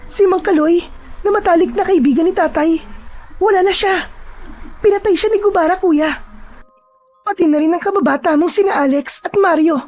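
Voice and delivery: put-on high-pitched, emphatic young woman; determined sister refusing to back down; resolute younger sister. Voices Amara in story dialogue.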